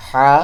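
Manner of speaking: thick, heavy "হা"; voz gruesa. Shown as a demonstration. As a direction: press the throat for a full "হা". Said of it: "আওয়াজ মোটা হবে".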